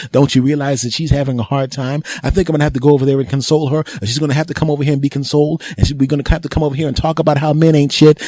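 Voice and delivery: the friend who calls to vent, mocked fast and high; mocking girlfriend's friend.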